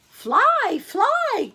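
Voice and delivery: high, loud alarm call; crying out fly. A narrator's reading. Birds warn the bees to flee, loud high cry. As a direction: shout the warning. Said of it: "cried the birds to the bees".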